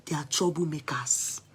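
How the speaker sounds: a hushed, confiding whisper; dropping to a whisper to share gossip; whispered aside. Real, not acted. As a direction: whisper it like a secret. Confiding gossip about neighbours.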